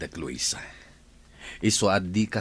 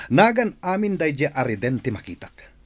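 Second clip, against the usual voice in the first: ordinary talk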